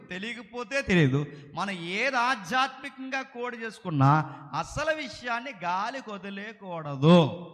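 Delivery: ordinary talk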